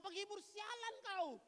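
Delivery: slow, high, exasperated retort; exasperated Job. Retold or imitated. Job at the end of his patience with friends. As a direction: bite off each word. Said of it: "udah nggak tahan dia".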